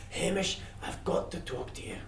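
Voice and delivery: hushed urgent aside; urgent whisper. Acted. Death pulling Hamish aside, urgent whisper. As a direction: pull him close and whisper.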